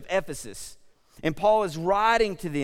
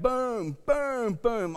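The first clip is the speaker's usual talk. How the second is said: axe thuds; 8 semitones higher, much slower than usual. Retold or imitated. Imitating axe hitting tree.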